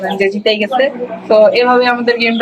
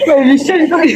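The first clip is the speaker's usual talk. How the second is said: laughing; 5 semitones higher than usual. Real, not acted. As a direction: burst out laughing. Laughs after losing the challenge.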